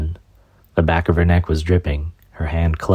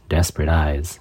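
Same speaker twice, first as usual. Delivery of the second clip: ordinary delivery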